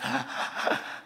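a sharp breathy sob-gasp; sobbing gasp. Retold or imitated. Preacher acting out a crying gasp for the congregation. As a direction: gasp in like someone crying too hard.

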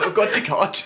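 loud high laughing cry; laughing shriek. Real, not acted. Laughing shock at story.

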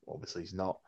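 normal talking voice

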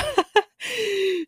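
high-pitched bright giggle. Real, not acted. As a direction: burst into a high little laugh. Laugh after imitating friend.

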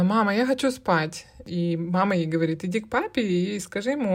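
ordinary delivery

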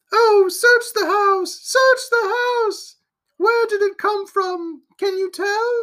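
slow, high falsetto wail of alarm; female voice. A narrator's reading. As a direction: plead as a frightened woman, very high and drawn out. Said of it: "a female voice said".

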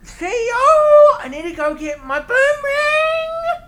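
very high, loud, slow Australian wail; Australian Cosby. Shown as a demonstration. Comic doing the promised impression, shrieked bad Australian accent. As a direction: stretch it into a shouted, badly faked Australian accent. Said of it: "do him in an Australian accent".

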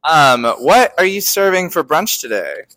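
ordinary talk, nothing marked

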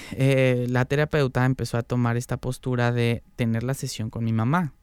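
ordinary talk, nothing marked

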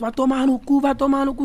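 hammed-up fans cursing bus to bus; mild mock chant of insults; imitating a jeering fan. Rival fans swearing from buses.

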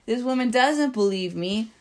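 ordinary talk, nothing marked

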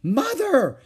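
loud, high cry of alarm; horrified cry. A narrator's reading. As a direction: cry out in horror. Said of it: "she cried, horrified at what she saw".

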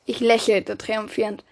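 ordinary delivery, nothing marked

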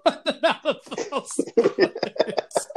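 high, slow words dissolving into laughter; real helpless giggles; laughing. Real, not acted. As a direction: lose it while finishing the story. Cracking up at the picture.

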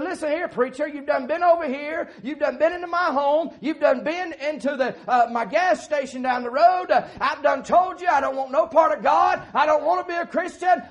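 loud, high, furious yelling at the preacher; angry shout. Retold or imitated. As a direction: blast him like an offended man driving off a preacher. Offended man yells at preacher.

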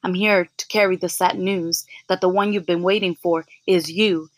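normal talking voice

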